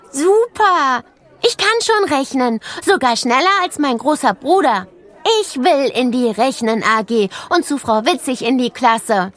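hoch und lauter, sprudelnd; aufgekratzt. A narrator's reading. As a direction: sprudle vor Begeisterung. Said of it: "Plötzlich war Anton richtig aufgekratzt". (Anton freut sich auf Rechnen: hoch, aufgekratzt)

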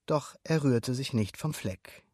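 ordinary delivery

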